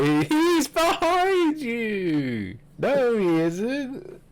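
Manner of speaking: high, slow pantomime call and response; pantomime audience. Retold or imitated. Mocking the segment as a pantomime, slow high panto chant. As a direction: sing it out like a panto crowd. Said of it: "it's like a pantomime".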